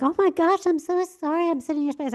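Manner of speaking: high-pitched, over-the-top sorry; mock profuse apologizing; gushing apology. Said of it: "it wasn't like".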